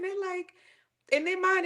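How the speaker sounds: ordinary delivery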